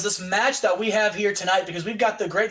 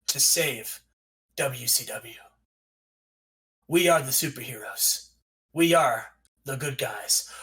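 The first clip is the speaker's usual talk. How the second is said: low, slow dramatic trailer narrator; solemn superhero voiceover; movie-trailer voiceover; 8 semitones lower, 4 dB quieter than usual. Acted. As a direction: intone it deep and hushed, with long pauses. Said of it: "you hear a voiceover that says".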